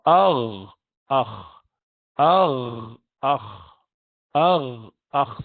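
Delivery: agh and akh scraped at the top of the throat; upper throat letters. Shown as a demonstration. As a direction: model ghain and kha. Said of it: "you have your ghain and kha".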